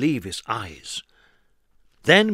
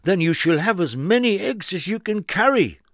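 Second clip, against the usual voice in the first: higher, generous farmer voice; 7 semitones higher than usual. Acted. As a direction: offer it grandly. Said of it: "said the farmer".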